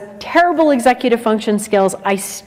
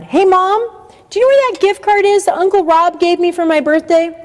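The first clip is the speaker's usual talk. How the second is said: calling out; 9 semitones higher than usual. Acted. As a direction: call down the stairs to mom. Teen calling downstairs to mom.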